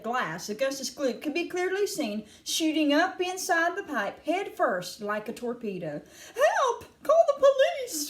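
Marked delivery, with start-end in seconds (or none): 6.3-8.1 very high, loud scream for help; shrieked, high-pitched alarm. A narrator's reading. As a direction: scream for rescue in panic. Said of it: "screamed Missus Gloop".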